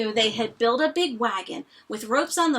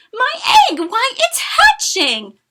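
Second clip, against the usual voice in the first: much higher, loud, joyful cry; shouted; 12 semitones higher, 10 dB louder than usual. Acted. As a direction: burst out in amazement, slowing on hatching. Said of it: "shouted Horton".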